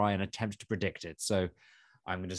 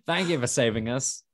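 ordinary talk

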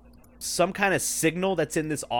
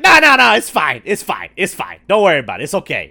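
loud, higher, hammed-up brushing it off; mock addict reassurance; 8 semitones higher, 11 dB louder, faster than usual. Addict brushing off a friend's concern, loud mock reassurance. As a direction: wave away the worry over and over, hammed up. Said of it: "But just to be like".

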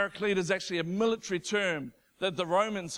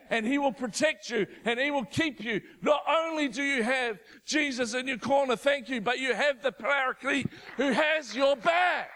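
preaching at full force; 4 semitones higher than usual. Acted. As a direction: preach it to the back row.

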